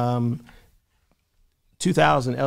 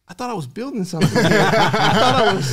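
higher, louder, half-laughing retold disbelief; 8 semitones higher, 5 dB louder than usual. Telling friends how he reacted to the rejection, laughing in disbelief. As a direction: laugh through the words as if you can't believe it. Retelling his own stunned reaction.

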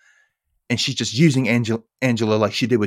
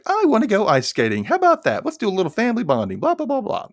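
high-pitched mimicked mom pitching an outing; mock eager mom; 10 semitones higher than usual. Mom suggesting family bonding at dinner, mock eager. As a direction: play a mom pushing family fun, high and hammed-up.

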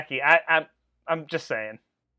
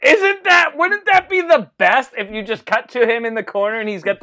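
excited; 6 semitones higher, 7 dB louder than usual. Real, not acted. Excited pitch of bedpan idea.

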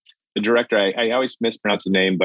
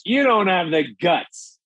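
higher, slow taunting dare; mocking taunt; 8 semitones higher, slower than usual. Chicago guy taunting the teller, high slow dare. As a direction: goad him like a cocky rival. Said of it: "And he goes".

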